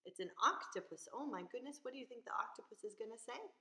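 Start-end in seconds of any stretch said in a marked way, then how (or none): none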